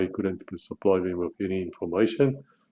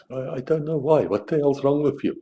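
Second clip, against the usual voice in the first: exasperated; 7 semitones higher than usual. Real, not acted. Exasperated at colonials.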